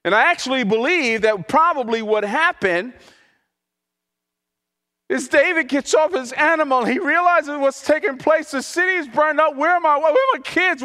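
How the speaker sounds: high, pressing preaching climax; impassioned preaching. Acted. Pastor picturing weeping David for the congregation, impassioned and high.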